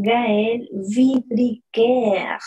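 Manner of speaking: Gaël Vidriquer very slow, syllable by syllable; slow model reading. Shown as a demonstration. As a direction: spell it out slowly for class. (Gaël Vidriquer muy despacio, sílaba por sílaba)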